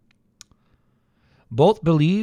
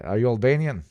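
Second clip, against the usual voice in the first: ordinary talk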